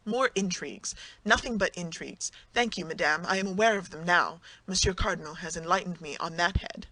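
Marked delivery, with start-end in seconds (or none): none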